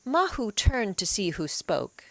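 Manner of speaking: ordinary delivery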